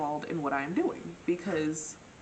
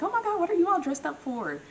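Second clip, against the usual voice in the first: squealing high "oh my God"; high surprised coworker voice; 12 semitones higher than usual. Retold or imitated. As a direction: gush it out way up high. Coworker voiced in retold scene.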